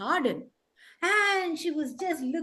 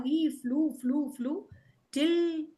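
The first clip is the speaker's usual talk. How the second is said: usual speaking voice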